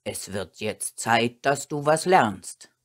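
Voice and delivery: low, serious master's voice; stern. A narrator's reading. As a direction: lay down the law to a dog. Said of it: "spricht Herr Barthel ernst".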